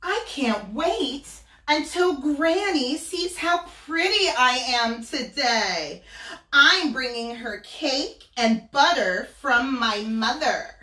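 put-on bright high voice of a vain little girl; sing-song Little Red bragging; high girlish Little Red. Wolf voicing Little Red telling of her trip to Granny. Little Red's voice, story read aloud.

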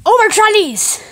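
high, loud exasperated yell; frustrated. Acted. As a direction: yell it out in disbelief. Frustrated outburst about the language.

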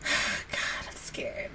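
sighed, barely audible whisper; scared whisper. Real, not acted. Viewer dreading next week's episode, scared whisper. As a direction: breathe out the fear in a whisper.

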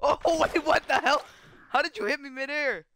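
high, startled outburst with a laugh; real surprise, high and incredulous; laughing disbelief. Real, not acted. Startled by a mid-air hit.

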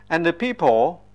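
ordinary talk, nothing marked

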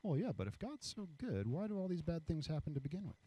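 muted, sing-song skepticism; skeptical nitpickers. Acted. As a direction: question it like a snide skeptic. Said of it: "these other people come over here".

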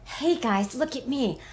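ordinary delivery

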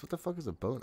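ordinary talk

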